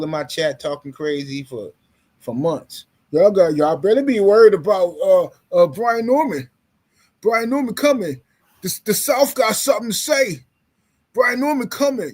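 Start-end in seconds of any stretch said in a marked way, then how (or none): 3.1-12.1 mock hype. Host mimics fans hyping Brian Norman, mock hype. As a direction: talk up the fighter like an excited fan.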